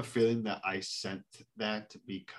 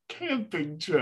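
put-on drunk voice; 9 semitones higher, 6 dB louder, slower than usual. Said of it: "And then I just go".

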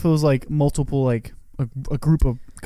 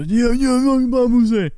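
high, garbled Cajun-style jabber; Waterboy coach; 10 semitones higher, 4 dB louder than usual. Retold or imitated. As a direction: mimic an unintelligible movie coach, pitched up. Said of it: "it's, like, this coach".

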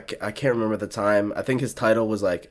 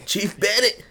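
high Southern twang, played for laughs; Southern accent; 10 semitones higher, slower than usual. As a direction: imitate a recruit's Southern accent, pitched way up. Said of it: "he would say it in this southern accent".

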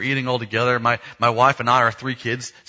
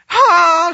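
loud, high imitated child's wail; sudden comic bawl; mock crying wail; 11 dB louder than usual. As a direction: burst out bawling like a kid, hammed up. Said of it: "she's crying again".

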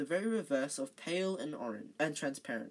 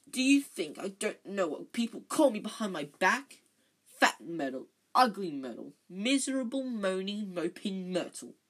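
tearful outburst; 4 semitones higher, 5 dB louder than usual. A narrator's reading.

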